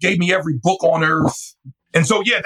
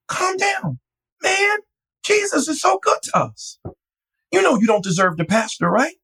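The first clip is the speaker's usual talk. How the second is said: much higher, small-man voice; tiny little fella; 11 semitones higher than usual. Retold or imitated. Calming panicked Doug at the restaurant, high little-man voice. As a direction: soothe him in a tiny high voice. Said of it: "he's a tiny little fella".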